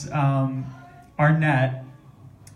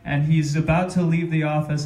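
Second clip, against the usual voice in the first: ordinary talk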